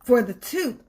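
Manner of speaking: plain reading voice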